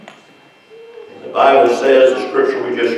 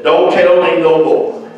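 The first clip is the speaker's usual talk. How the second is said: higher-pitched retold protest; mock refusal; 3 semitones higher than usual. Woman cutting off the preacher's witnessing, mock refusal. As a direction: imitate someone insistently shutting down questions.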